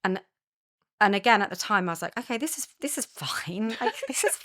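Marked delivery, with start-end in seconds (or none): 2.2-4.4 mock cheerful denial. Mock-calm reply, nervous.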